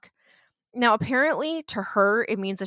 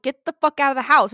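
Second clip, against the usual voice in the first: a loud, hammed-up shout to get out; retold yell; 5 semitones higher, 8 dB louder than usual. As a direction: bark the order like a scared priest. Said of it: "Frank is like".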